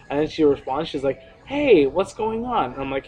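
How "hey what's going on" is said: put-on high, drawn-out girly hey; girl's greeting. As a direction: imitate a girl's slow, lilting greeting. Voicing girl in retold chat.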